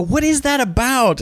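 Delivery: high, slow, puzzled voice; baffled crew member. Retold or imitated. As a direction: play the confused grip. Crew's baffled question.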